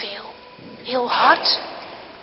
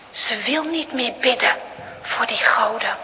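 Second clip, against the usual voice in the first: usual speaking voice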